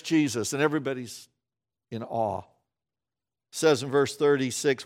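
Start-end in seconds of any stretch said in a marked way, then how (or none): none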